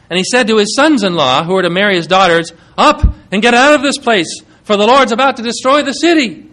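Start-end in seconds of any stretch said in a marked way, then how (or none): 2.8-6.4 louder and higher, pressing urgency; urgent warning. Acted. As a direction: call them out of the city. Lot warns his sons-in-law.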